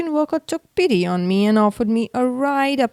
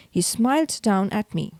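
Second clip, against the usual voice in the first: plain reading voice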